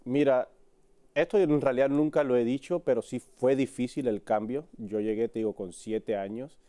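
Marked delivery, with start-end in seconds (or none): none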